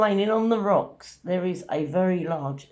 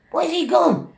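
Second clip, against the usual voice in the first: high, loud, retold exclamation; startled question; 11 semitones higher, 7 dB louder than usual. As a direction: call out in mock alarm, pitched right up.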